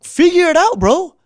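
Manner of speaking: yelling at a boss who passes the buck; angry yell. Retold or imitated. Yelling at Chip on the phone, furious and loud. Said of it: "I was pissed, like, super pissed".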